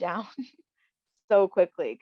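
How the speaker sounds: normal talking voice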